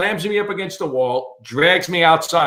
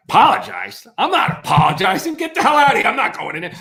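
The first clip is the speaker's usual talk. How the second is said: higher-pitched disbelieving outburst; acted indignant refusal; 8 semitones higher than usual. Intruder refusing the cop's order, acted indignation.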